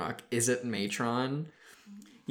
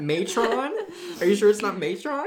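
teasing; 8 semitones higher, 4 dB louder than usual. Pretend. Teasing with joke word.

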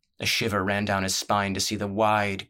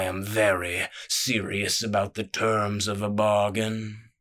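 genial menacing boss. Acted. Crime boss explaining his trade to the thief, genial menace. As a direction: sound generous while the threat hangs. Voices the jackdaw Killam.

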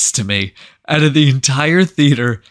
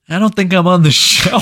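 alarmed realization; 8 semitones higher, 6 dB louder than usual. Retold or imitated. Retells dawning panicked realization.

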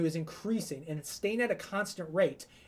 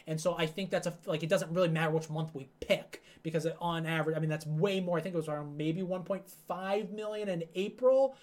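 ordinary talk, nothing marked

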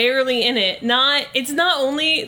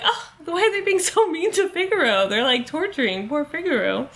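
higher-pitched pitying wail for a cat; mock distress; 6 semitones higher than usual. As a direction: plead for the poor cat, hammed up. Voicing own pity for cat.

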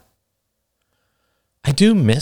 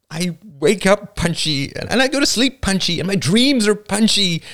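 feisty; 10 semitones higher than usual. A narrator's reading. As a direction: punch every word with fists raised. Alice raising her fists.

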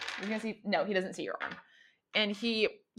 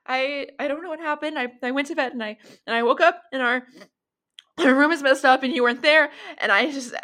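high, rising panic, louder as it goes; crying, panicked; 7 semitones higher, 5 dB louder, faster than usual. Acted. Husband crying in fear after waking up hurt, rising panic. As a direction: voice a frightened crying man, building up. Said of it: "crying into you".

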